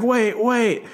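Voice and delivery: imitated woman crying wait; frantic woman. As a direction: call out desperately as the mother. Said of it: "she's like".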